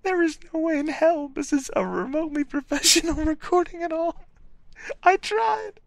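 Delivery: high, squeaky voice breaking with laughter; real laughing fit. Real, not acted. Reader giving up on professional recording, squealing with laughter. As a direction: squeal it helplessly through laughter.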